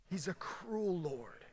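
whispered warning. Real, not acted. Solemn repeat of a warning.

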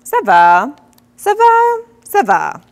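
repeated greeting. Retold or imitated. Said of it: "And then she says".

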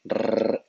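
trilled R sound; rolled R demo. Shown as a demonstration. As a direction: trill the Rrr long and strong. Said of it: "la pronunciación de la R".

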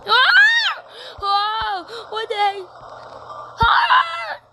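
high, loud yelps of panic; panicked screams. Real, not acted. Gamer on fire in the nether, high panicked screams. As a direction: scream like you're burning.

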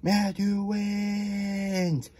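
high, long sustained cheer; crowd yell. A narrator's reading. Everybody cheering the winner, high drawn-out yell. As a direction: sing out the cheer high, holding the last word. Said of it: "everybody yelled".

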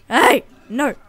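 sudden loud yell; shout. Real, not acted. Gamer yelling at a wild animal, loud. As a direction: yell hey at the attacker.

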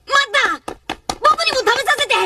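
high urgent cry to stop them; boy shouts to be let in; calling out. Acted. Boy interrupting the feast, high pleading shout.

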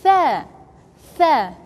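tha with the tongue between the teeth; tha sound. Shown as a demonstration. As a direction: model tha. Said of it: "This one is".

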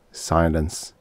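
ordinary delivery, nothing marked

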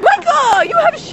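high squealed accusation; hammed-up shocked friend; mock shriek. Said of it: "And I'm like".

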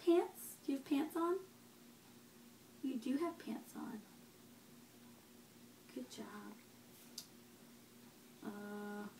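put-on quiet, gentle questions to a baby; soft parent voice. Parent quietly quizzes the baby on body parts and objects. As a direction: ask softly, near a whisper.

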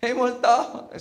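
high, loud frightened cry of ghost; hammed-up panic at a ghost; mock scared shout. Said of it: "Sabi ni Thomas". (kunwaring takot na sigaw)

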